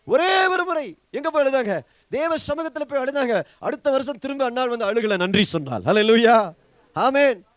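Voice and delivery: high-pitched, ringing proclamation; emphatic preaching. Real, not acted. Preacher tells how Hannah wept only once, high and emphatic.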